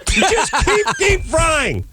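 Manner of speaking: very high, slow, laugh-broken shout; laughing mock yell. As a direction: yell orders while cracking up. Imagined cook yelling, laughing.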